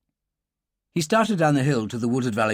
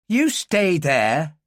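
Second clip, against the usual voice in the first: slow, firm Irish warning, louder; strong Irish accent; 4 semitones higher, 4 dB louder, much slower than usual. Acted. Irish girl warning the stranger back, strong Irish accent. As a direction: plant it in Irish, slow and hard. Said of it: "The Irish accent was very pronounced".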